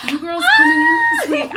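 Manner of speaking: excited. Real, not acted. Gushing to guests.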